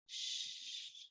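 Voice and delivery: long whispered "shh"; soft, sustained hushing hiss; shushing. Acted. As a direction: hush the devil with a drawn-out shh. Hushing in poem about loud mama.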